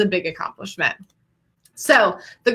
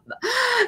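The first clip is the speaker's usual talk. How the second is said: sharp, high gasp of delight; 4 dB quieter than usual. Real, not acted. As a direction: gasp in happy surprise. Sees a graduate's champagne on camera.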